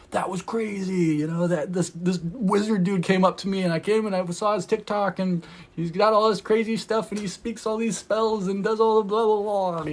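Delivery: a stranger telling friends about the wizard dude; amazed stranger retelling. Retold or imitated.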